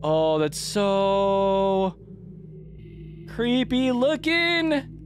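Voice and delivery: slow, high, drawn-out dread; creeped-out whine. Real, not acted. Player staring at creepy figures, creeped-out whine. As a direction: stretch it out, climbing high in unease. Creeped out right after a scare.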